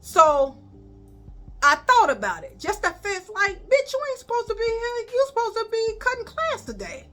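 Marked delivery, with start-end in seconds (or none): 2.6-7.1 high, scolding herself inside; telling herself off in a raised voice; mock self-scolding. To herself at the classroom door, high mock scolding.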